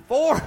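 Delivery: "Four" barked high and loud; "Four" said high, loud and sharp; stressed four. Retold or imitated. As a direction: jab out "Four" like you're pointing at the tag. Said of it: "that says".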